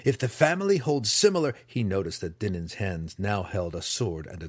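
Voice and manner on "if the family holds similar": spat. A narrator's reading. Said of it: "Drizzt spat".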